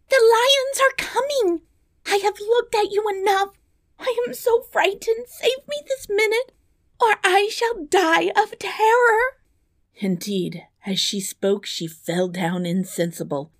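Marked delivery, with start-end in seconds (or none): 0.1-9.4 extremely high, panicked princess; frantic tearful plea for rescue; terrified cry. Acted. Princess begs the dwarf to save her, high terrified cry. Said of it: "cried she".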